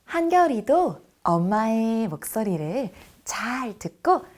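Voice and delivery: ordinary talk, nothing marked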